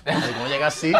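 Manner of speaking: normal talking voice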